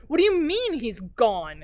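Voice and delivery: loud, slow, insistent; slow furious demand; demanding shout. Acted. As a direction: hammer the question home. Daughter demands answer, louder.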